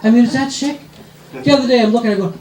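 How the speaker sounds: normal talking voice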